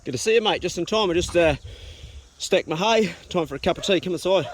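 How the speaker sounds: usual speaking voice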